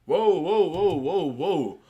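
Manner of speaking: loud, rising whoas that fade down; a real shocked double take, trailing off; stunned whoa. Real, not acted. Host reacting to the guest's claim, stunned whoas.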